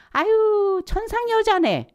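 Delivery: high, loud mock old lady sighing 아유 천상 여자네; old folks' remark. Elders teasing a shy girl, high mocking voice. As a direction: imitate a tutting elder, way up high. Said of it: "막 이래".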